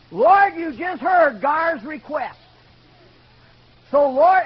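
loud, high, fervent prayer; praying out loud. Retold or imitated. Gordy praying on the shop floor, out loud. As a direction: call up to God in a big voice. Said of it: "he prayed out loud".

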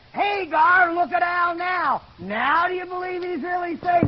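high, loud, excited call; triumphant challenge. Retold or imitated. Gordy showing Gar the new Al, triumphant. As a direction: crow it across the room at him.

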